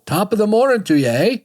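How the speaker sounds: hammed-up Irish lilt, pitched high and quick; a jokey stage Irish "Top of the morning" with a Canadian "eh"; mock Irish greeting. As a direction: toss off a cheesy fake Irish hello. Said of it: "that's Scottish".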